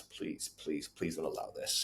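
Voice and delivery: very soft, low plea; hushed pleading. Retold or imitated. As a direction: beg silently, barely voicing it. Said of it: "pleading with her eyes".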